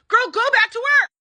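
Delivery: high, loud, pleading command; exasperated scolding. Real, not acted. Recapper scolding Julia through the screen, fed up. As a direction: yell it at her like a fed-up friend.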